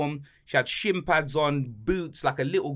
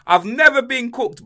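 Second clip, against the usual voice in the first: higher, louder and slow disbelief; indignant protest; 9 semitones higher, 9 dB louder than usual. Real, not acted. Insisting to his co-host he's never been roasted, loud and slow. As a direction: stretch it out, still offended.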